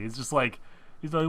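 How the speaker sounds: usual speaking voice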